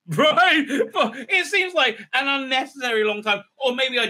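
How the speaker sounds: laughing through the words; giggling while complaining. Real, not acted. Laughing at the show.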